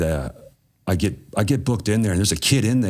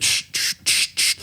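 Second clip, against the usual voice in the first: shushing hiss. Retold or imitated. Ducking into the cell.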